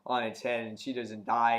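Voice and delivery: usual speaking voice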